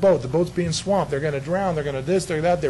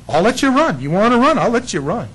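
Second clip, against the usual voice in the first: louder, higher warning of I'll let you run; stern warning; 7 semitones higher, 6 dB louder than usual. Retold or imitated. God warning the runaway, raised stern voice. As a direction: warn him, voice raised. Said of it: "God's voice warning".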